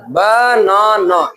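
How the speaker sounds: बनाना drawn out slowly as ब... ना... ना; three long separate beats on banana; broken into syllables. Shown as a demonstration. Teacher proving three breaks to class, banana split by syllable. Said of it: "तीन बार".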